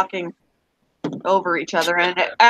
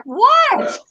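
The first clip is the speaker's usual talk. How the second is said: sudden high bewildered what; confused what; 3 dB louder than usual. Retold or imitated. At Pizza Hut, everyone laughing, a baffled high what. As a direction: blurt it out, baffled. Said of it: "I was like".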